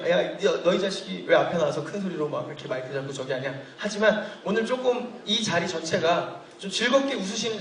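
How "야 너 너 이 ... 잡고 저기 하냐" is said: grumbling audience member. Acted. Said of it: "몇 분들은 조금 그런 거거든요".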